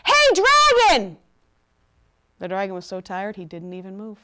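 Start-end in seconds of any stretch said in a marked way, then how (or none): 0.0-1.2 high, slow, very loud yell; all-out shout. A narrator's reading. Princess yells into sleeping dragon's ear, as loud as possible. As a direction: shout as loudly as you can. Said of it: "shouted as loudly as she could".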